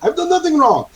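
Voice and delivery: very high, insistent protest; high-pitched prisoner who won't let up; going on and on. Acted. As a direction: keep protesting innocence in a high voice. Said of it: "going on and on about that".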